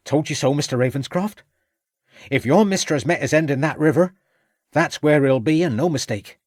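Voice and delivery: cocky servant lad. Acted. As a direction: crow it like a lad proved right.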